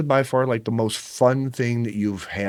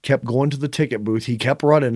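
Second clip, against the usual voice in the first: usual speaking voice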